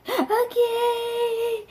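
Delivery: tiny high tearful squeak; soft, pitiful pretend sob; mock crying. Child accepting that the friend must leave, mock tearful. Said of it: "I was crying".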